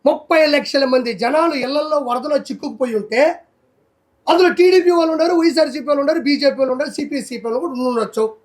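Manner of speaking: ordinary talk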